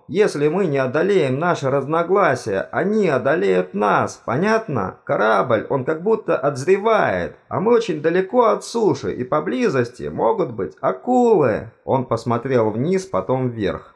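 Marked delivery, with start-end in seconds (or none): 0.1-11.7 higher, insistent plea; urgent calling out. A narrator's reading. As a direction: press the warning on squabbling colleagues. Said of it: "выкрикнул он". (взволнованный, настойчивый призыв)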